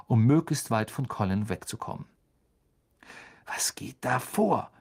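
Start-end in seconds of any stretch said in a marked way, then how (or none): 3.5-4.7 unvoiced, slow, hushed puzzlement; frowning whisper. A narrator's reading. Wood frowning as he glides over, in a slow whisper. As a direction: breathe it out under a frown. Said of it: "sagte Wood stirnrunzelnd".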